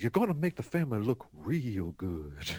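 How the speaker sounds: low, quiet confiding dad; Burt Reynolds. Acted. Dad leaning in to his son, low confidential impression. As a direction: lean in close, keep it hushed and deep. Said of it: "he did a Burt Reynolds thing".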